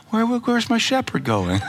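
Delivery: high, little lost-sheep voice; worried sheep voice. Retold or imitated. Preacher voices his heart as a lost sheep, high and small. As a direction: plead like a worried lamb. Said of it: "And then my heart said".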